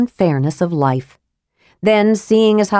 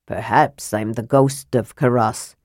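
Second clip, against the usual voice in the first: low, soft dwarf voice; hushed low-voiced old dwarf; 8 semitones lower, 4 dB quieter than usual. A narrator's reading. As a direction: suggest it quietly, pitched way down. Old dwarf figure answers the kender.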